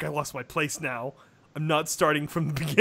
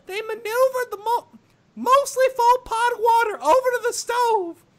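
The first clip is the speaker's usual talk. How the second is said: lightly raised narrator voice for ponies; high storytelling voice; 7 dB louder than usual. Acted. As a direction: read it a bit higher and bright.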